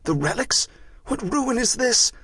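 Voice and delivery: high, bewildered questioning; shocked young friar, pitch climbing high; distraught disbelief. Acted. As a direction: demand an answer in rising dismay. Shocked friar finds relics gone.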